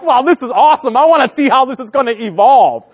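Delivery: high, loud, laughing delight; delighted amazement. Retold or imitated. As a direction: marvel at the mess like it's a show. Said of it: "I'm like".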